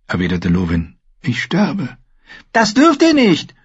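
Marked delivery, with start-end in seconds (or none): 1.2-2.0 a hint of a weak old man; lightly voiced dying maester. Maester tells Osha, faint and resigned. 2.5-3.6 put-on high, loud child's protest; angry little boy. Rickon protests to the dying maester, high and loud. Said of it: "widersprach Rickon zornig".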